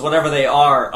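normal talking voice

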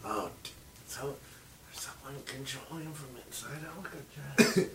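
excited whisper. Real, not acted. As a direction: hush it, thrilled and soft. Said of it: "Why are we whispering?".

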